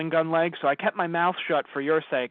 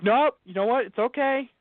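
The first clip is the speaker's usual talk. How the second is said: mock acceptance; 9 semitones higher than usual. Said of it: "I'm like".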